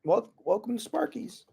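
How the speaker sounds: high, soft squeaky welcome; mock Ness. Ness welcoming, high quiet squeak.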